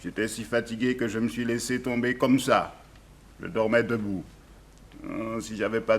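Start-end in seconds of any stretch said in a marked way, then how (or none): none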